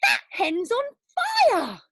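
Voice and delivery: very high, slow, loud cry; grandma shrieking at the fire; alarmed yell. Acted. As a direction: yell out in panic. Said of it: "Grandma yelled".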